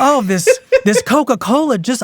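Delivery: Southern belle. Retold or imitated. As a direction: play the faint Southern belle, hammed up. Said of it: "making her a Southern belle".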